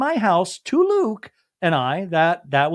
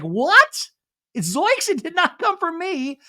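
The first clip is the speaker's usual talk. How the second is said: high-pitched mock disbelief; imitating someone aghast at being blamed; incredulous denial; 12 semitones higher, slower than usual. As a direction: act out an indignant, high-voiced denial. Retold indignant denial from cast member.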